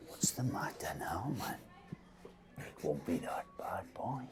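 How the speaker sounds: conspiratorial whisper. Acted. Victor whispering to a worried young man, confiding. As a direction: lean in and whisper the joke.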